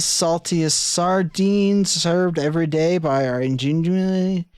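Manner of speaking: hammed-up restaurant promo; cheesy ad read. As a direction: sell the sardines like a TV ad. Reads an in-game advert.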